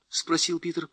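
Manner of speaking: ordinary delivery, nothing marked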